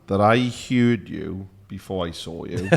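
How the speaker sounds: ordinary talk, nothing marked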